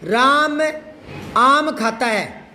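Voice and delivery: slow, with a pause after Ram; deliberate, spaced-out model sentence; spoken dictation. Shown as a demonstration. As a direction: say it slowly so they write it mentally. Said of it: "वाक्य बोल के बता रहा हूँ, ध्यान से सुनना".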